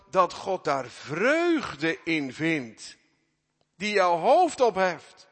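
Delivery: loud, high, full-force sermon delivery; fervent preaching. Acted. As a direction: proclaim it with full force to the congregation.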